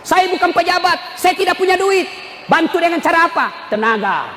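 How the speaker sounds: high, declaimed sermon voice; full-force preaching. Acted. Preacher speaking as a man without money, full-force preaching. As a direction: throw the question out to the crowd.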